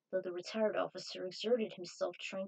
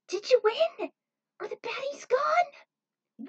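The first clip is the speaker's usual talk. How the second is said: much higher and louder, slow; excited child voice; 10 dB louder, slower than usual. Acted. Edward asks the returning friends if they won, high and excited. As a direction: bounce in like an eager little kid.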